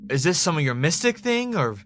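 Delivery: panicked disbelief; rattled outburst. Acted. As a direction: blurt it out, still shaken from the vision. Ray shaken after the vision.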